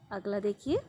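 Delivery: normal talking voice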